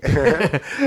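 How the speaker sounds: short bright laugh; burst of laughter. Real, not acted. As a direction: laugh out loud. Laughs at interviewer's remark. (צחוק קצר ומתגלגל)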